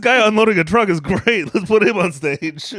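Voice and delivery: high, laughing exec voice; mock executive. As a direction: play a gushing TV producer. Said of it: "They're like".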